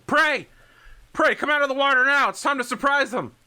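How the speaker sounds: high, loud commander yelling; shouted battle order. Acted. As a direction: yell orders over the battle radio. Haller shouting orders over the radio.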